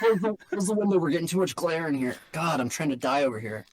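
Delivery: high, fast fussy actor; playful hammed-up complaint; mock diva. Acting out film set bit.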